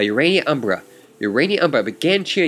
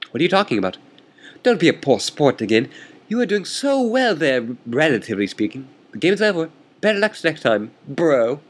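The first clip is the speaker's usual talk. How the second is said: lightly voiced haughty opponent, pitched higher; smug chess rival; 4 semitones higher than usual. Chess rival taunting the player, lightly voiced and smug. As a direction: needle your opponent with mild mock arrogance.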